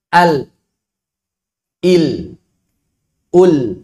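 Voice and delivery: al, il, ul with the same thin lam; even, thin lam in every syllable; correct lam. Shown as a demonstration. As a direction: keep the lam in al, il, ul equally thin.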